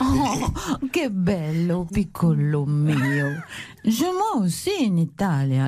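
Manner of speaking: put-on Italian accent, swooping and languid. Wife gushes about culture at home in Italy, languid Italian accent. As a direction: gush in Italian-accented French.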